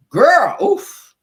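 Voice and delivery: loud high oof. Real, not acted. Host reacting to the church outburst, loud. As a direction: exhale it loudly in disbelief.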